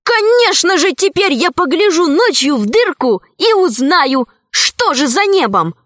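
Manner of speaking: bursting with the new idea; восторженно. A narrator's reading. Boy excited by the idea. (в восторге)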